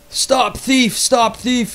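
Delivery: high-pitched mock scream, not full volume; strained high yell, held back; retold scream. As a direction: re-enact crying out after a thief. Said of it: "I'm screaming".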